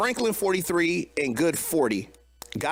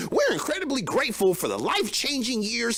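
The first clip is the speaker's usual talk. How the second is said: mocking, higher-pitched politician's speech; Kamala Harris; 6 semitones higher than usual. Podcast host mocking a politician's public statement, in a Kamala Harris impression. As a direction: put on a higher voice for insincere, synthetic statement-speak. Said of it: "she talks just like this".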